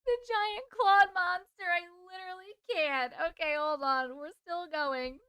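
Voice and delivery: can barely get it out for laughing. Real, not acted. Cracking up at own story.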